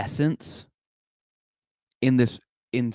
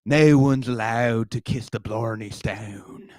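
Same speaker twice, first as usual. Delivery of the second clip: slow, exaggerated Irish brogue; Bill Maher with an Irish accent; much slower than usual. Comic as an Irish talk-show host, slow hammy Irish accent. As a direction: drag it out in a hammed-up Irish accent. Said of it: "an offensive Irish accent and talking about the Blarney Stone".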